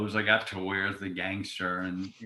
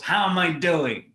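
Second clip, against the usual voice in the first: higher, louder, drawn-out shout; loud mock call-out; 7 semitones higher, 8 dB louder than usual. Actor asking castmates after his monologue, loud drawn-out call. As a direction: call it out slowly, pitched up high.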